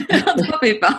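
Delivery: real laugh carrying the words; laughing reassurance. Real, not acted. Laughs off the other's apology.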